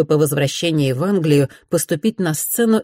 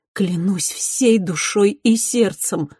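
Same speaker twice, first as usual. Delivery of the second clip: tender vow, slow; 4 semitones higher, slower than usual. Acted. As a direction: swear it gently and slowly. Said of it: "проливая слёзы".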